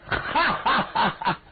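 high, slow hearty ha-ha-ha; hearty laugh. Acted. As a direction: laugh out big and pleased.

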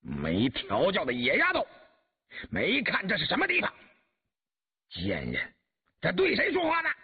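angry rebuke leaping up in pitch; outraged, high-strung reprimand; stern scolding. A narrator's reading. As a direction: scold an insolent inferior, rising to an indignant high question. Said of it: "厉声斥道".

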